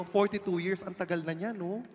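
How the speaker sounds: ordinary talk, nothing marked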